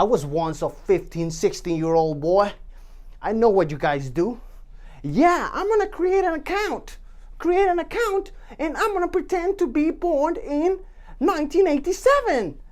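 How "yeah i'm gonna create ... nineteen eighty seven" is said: high mock teen voice, pitched well up; mock teenager. Vlogger mocking underage viewers, in a high teen voice. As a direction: play the sneaky kid lying about his birth year.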